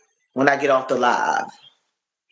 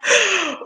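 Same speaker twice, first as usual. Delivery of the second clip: sharp gasp. Real, not acted. Reacting to a chat comment.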